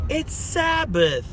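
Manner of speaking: high, slow, put-on squeak; playful fake outrage; mock protest. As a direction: squeal it out in a silly voice.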